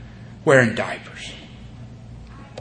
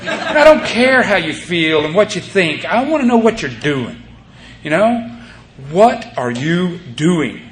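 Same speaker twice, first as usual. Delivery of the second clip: higher, louder, laughing scolding; exasperated outburst; 6 semitones higher, 4 dB louder, slower than usual. Retold or imitated. Sponsor losing patience with a talkative sponsee, laughing scold. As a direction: snap at the sponsee, half laughing. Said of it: "I go".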